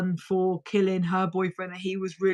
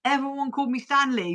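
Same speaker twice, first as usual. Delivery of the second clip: ordinary talk, nothing marked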